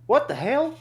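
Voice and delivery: put-on high, loud startled kid question; imitated little boy. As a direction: mimic a small boy blurting in surprise. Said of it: "little four-year-old boy".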